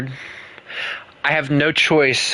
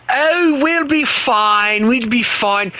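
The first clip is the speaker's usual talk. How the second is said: high, slow, mock British confidence; a high, lazy posh English mockery; 12 semitones higher, slower than usual. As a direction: lampoon a smug British soldier. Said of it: "when they see farmers".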